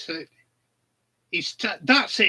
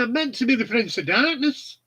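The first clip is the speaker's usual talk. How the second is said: lightly mimicked indignant Brummie rocker; mock-indignant Brummie, raised pitch; 7 semitones higher than usual. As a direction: repeat the punchline high, in a mild Brummie impression. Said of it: "it were in a Brummie accent".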